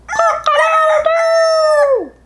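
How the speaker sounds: high, drawn-out rooster crow; screaming rooster. A narrator's reading. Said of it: "he screamed".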